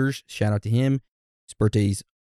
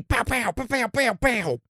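sound effect; 11 semitones higher than usual. Acted. Sound effect after shout out.

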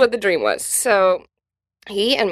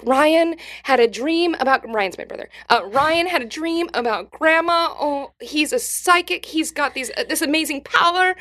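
mock awe; 6 semitones higher than usual. Family mocking brother as psychic.

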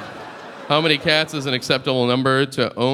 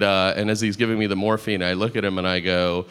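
ordinary talk

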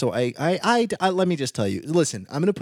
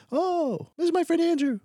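put-on high, boyish puppet voice; Pinocchio voice. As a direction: play a wooden boy, much higher. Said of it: "Pinocchio voice".

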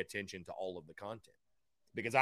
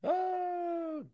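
surprised. Real, not acted. Reacting to a funny comment.

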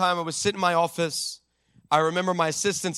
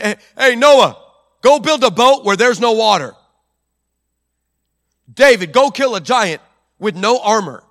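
a hint of a casual offhand God; God casually giving Noah and David impossible orders; God improvising; 3 semitones higher, 7 dB louder than usual. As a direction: toss out orders like an afterthought. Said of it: "making this up as he goes".

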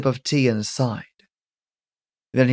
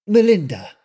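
Melinda giving her name; 7 semitones higher than usual. Acted. Melinda tells Calvin her name, higher and firm. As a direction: say your name plainly and firmly. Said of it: "she told him".

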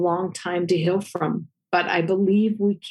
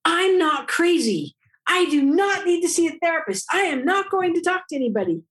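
higher, insistent denial, speeding up at the end; indignant protest; 11 semitones higher than usual. Retold or imitated. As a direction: push back hard against being sent to therapy. Retold protest to the dean.

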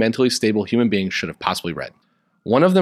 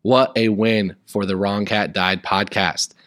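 story reading; 2 semitones lower than usual. A narrator's reading. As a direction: read the fan fiction like a dramatic storybook. Reads fan fiction aloud over music.

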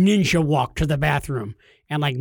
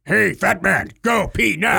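mock bladder voice; 4 semitones higher, 5 dB louder, slower than usual. Bladder personified.

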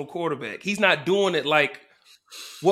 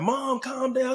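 high shout of a grown son; son calming mom; 7 semitones higher, slower than usual. Retold or imitated. Fan's son telling his angry mother to calm down. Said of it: "this guy's like".

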